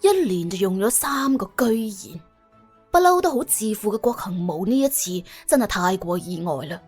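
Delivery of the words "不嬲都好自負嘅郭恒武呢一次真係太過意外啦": usual speaking voice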